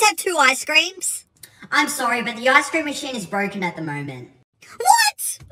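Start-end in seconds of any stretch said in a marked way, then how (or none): 1.7-4.3 low, lightly voiced cashier; drive-through worker. Worker gives bad news. 4.8-5.4 high, shouted shock; shocked outburst. Acted. Ice cream machine broken.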